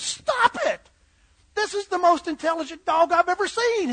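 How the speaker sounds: high, loud outraged protest; indignant shout. Acted. Butcher stopping a man kicking the dog, indignant shout. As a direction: cry out to halt a kicking.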